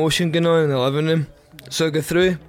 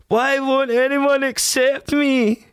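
high, slow, wailing sob of a plea; drawn-out tearful screaming plea; bawling plea; 11 semitones higher, 3 dB louder, much slower than usual. Retold or imitated. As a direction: wail it like a grown man crying like a baby. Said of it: "Screaming, pleading".

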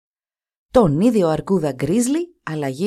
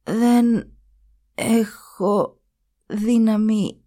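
weak words with long gaps between them; barely find the strength for each word; slowly; 3 semitones higher, much slower than usual. Acted. The revived mother answers her son, slow and halting. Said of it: "είπε εκείνη αργά".

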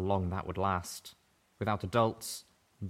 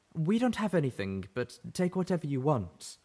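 higher, anxious offering; desperate pleading; 8 semitones higher than usual. A narrator's reading. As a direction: plead with strangers. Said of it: "she called out".

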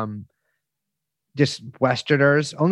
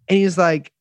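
ordinary talk

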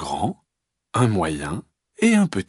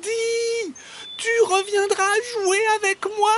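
very high, tiny calling voice; baby bear calling. Acted. Baby bear calling after the fleeing fox, tiny high call. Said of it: "petit ours lui crier".

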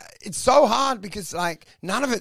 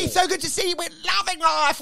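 higher, sugary put-on cheer; mock fake happiness; 9 semitones higher, 3 dB quieter than usual. Imitating his ex putting on fake cheer in public. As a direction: gush like everything is perfect. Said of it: "she's just like, happy".

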